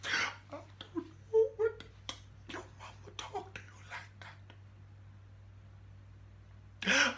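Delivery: high, whispered old-woman put-on; mock slave-era voice. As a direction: whisper it in a hammed-up old mammy voice. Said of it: "she just starts speaking full slave language".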